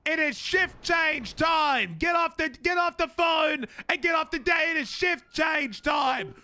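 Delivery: shouting. Acted. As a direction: bellow the orders across the room. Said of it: "I'm losing my voice".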